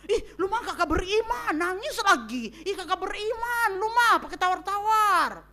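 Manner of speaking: high, loud scolding Jakarta voice; nagging busybody telling a mourner to stop crying; mock pushy comforter. A preacher mimicking a tactless visitor scolding a grieving friend.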